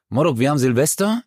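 ordinary talk

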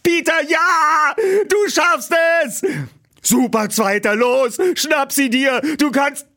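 high, loud shouts of support; loud cheering. Acted. Two friends cheering Peter from the roadside, loud high shouts. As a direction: cheer your friend to the finish. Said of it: "feuerten ihren Freund lautstark".

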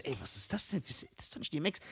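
softly retold inner remark; under the voice, in quiet disbelief; muted disbelief. Telling his first thought on seeing the rapper, hushed disbelief. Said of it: "Ich so".

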